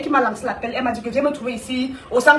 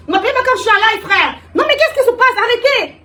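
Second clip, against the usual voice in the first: higher, louder, flustered protest; snapping at the phone interrupting her live; exasperated outburst; 9 semitones higher, 4 dB louder than usual. Real, not acted. Annoyed at a call ringing mid-live, she cries out higher and louder.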